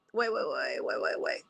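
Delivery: real whisper, lost in her notes; whispering under her breath while finding her place; whispered to self. Real, not acted. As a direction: breathe the words to yourself. Muttering to herself over notes.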